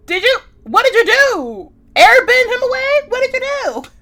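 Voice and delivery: high, loud disbelieving yelp; stunned outrage; shocked. Real, not acted. Shocked by the airbending.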